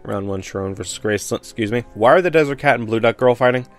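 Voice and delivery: ordinary talk, nothing marked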